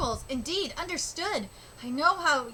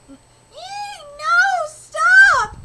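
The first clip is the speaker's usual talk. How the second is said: high, loud staged shriek; fake scream; 13 semitones higher, 12 dB louder, much slower than usual. Ranger screaming on cue to lure goblins, high and loud. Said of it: "give us a good scream".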